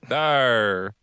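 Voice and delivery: gruff pirate arr; pirate growl. Pretend. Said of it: "talk like a pirate".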